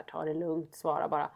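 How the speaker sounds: soft, low inner voice; calming self-talk. Retold or imitated. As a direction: talk yourself down quietly. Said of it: "man tänker så här".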